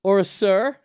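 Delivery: high, loud mock waiter query; waiter impression. Waiter realising the drink was his, high, loud mock question. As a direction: pitch it up like a waiter correcting himself. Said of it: "he went".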